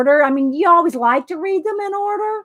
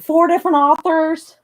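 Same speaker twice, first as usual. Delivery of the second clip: ordinary delivery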